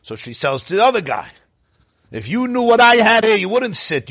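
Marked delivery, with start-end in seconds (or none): 2.1-4.1 loud, high, indignant mock demand; acted brazen insistence; pushy demand. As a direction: raise the voice to shoo someone away. Pushy woman demands a seat.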